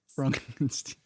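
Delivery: slow, quiet correction of Frankenstein to FRONK-en-steen. Acted. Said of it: "It's pronounced Frankenstein".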